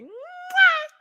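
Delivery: loud kiss. Real, not acted. Blowing kisses to viewers.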